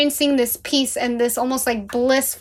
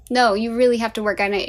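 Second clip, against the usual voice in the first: normal talking voice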